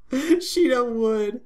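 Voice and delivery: high, slow drawn-out tease; giggly tease. Real, not acted. Player teasing about Shido's heroism, high giggle. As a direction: tease him sweetly.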